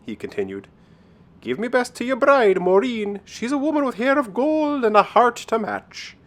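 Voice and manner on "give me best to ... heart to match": high-pitched Irish sprite voice; parting leprechaun. Acted. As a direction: send good wishes in a high, lilting leprechaun voice.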